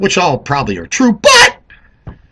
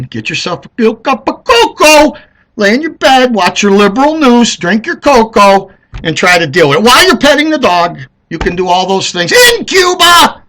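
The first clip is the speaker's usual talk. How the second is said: mock comfort; 8 semitones higher, 7 dB louder than usual. Real, not acted. Taunting Dems with comfort advice.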